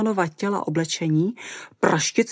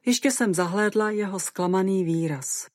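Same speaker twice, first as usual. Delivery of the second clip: ordinary delivery, nothing marked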